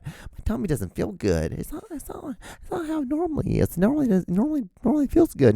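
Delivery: high, soft, pitiful mock whimper; hammed-up little whine, words dragged out; whiny tummy-ache sufferer. Mimics someone with tummy ache.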